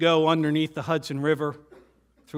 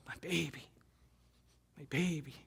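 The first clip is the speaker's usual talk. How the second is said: much softer, tender, a hint of mom sobbing; mild retold sob; 13 dB quieter than usual. Mother hugging her son home, mild retold sob. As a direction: coo it like a relieved mother, understated. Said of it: "she sobs".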